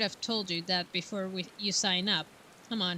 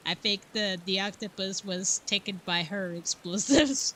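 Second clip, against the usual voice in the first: ordinary delivery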